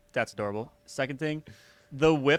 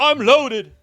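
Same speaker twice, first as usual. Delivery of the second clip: high, loud boast; mock tough guard; 10 semitones higher, 12 dB louder than usual. Bluffing an imaginary intruder, loud mock bravado. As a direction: puff up like an armed hero. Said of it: "I'd be like".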